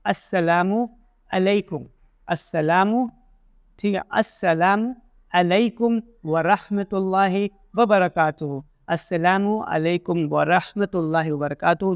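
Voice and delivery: अस्सलामु broken into parts, then said slowly; careful drill. Shown as a demonstration. Teacher drilling the greeting in parts. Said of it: "सही से pronounce".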